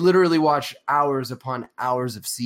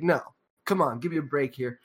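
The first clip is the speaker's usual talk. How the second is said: ordinary delivery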